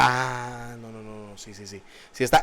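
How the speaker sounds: a dawning, embarrassed acknowledgment, dropping right off; sheepish, quiet "no, no, sí, sí"; sheepish realization. Retold or imitated. People realizing, fading.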